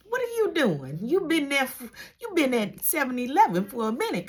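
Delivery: ordinary talk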